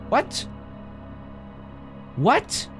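ordinary delivery

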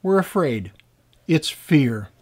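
ordinary talk, nothing marked

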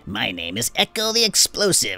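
proud, gleeful goblin voice. Acted. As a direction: announce your name like a cocky goblin. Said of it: "I won't be doing Echo's voice very often".

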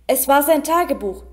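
usual speaking voice

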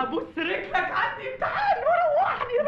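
laughing, high mock pleading; mocking laughter. Doorman mocking the young lover, laughing and high. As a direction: tease him through laughter.

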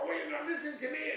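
high-pitched mock complaint; retold protest. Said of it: "at first I want to get more".

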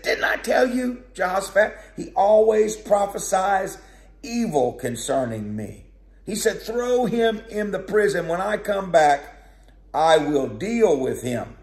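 a king who won't hear bad news; furious Ahab ordering the prophet jailed; angry king. Ahab complaining, then ordering prison.

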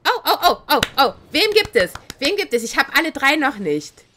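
ordinary talk

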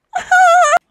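very high, loud puppet laugh; Elmo laugh. Shown as a demonstration. Reactor capping his Elmo impression, loud squeaky Elmo laugh. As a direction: cackle like an over-the-top Elmo. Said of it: "I can do the Elmo voice better".

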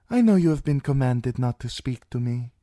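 put-on high, light young princess; sweet, scheming young princess. A narrator's reading. Princess voiced in audiobook.

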